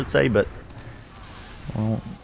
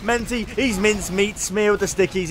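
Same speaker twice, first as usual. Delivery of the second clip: higher, building commentary call; excited play-by-play; 8 semitones higher than usual. Real, not acted. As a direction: sell the rush of the play. Caster hyping a play.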